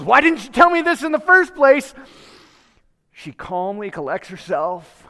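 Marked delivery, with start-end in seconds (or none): 0.0-1.9 higher, louder exasperated yell; mock scream. Teacher losing it at the boy, mock screamed. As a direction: blow up at the kid in a hammed-up teacher scream. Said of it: "Instead of screaming at the top of her lungs".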